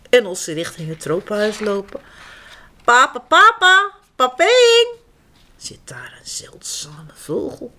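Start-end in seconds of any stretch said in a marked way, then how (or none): 2.8-4.9 bird call. Acted. Strange bird calling out.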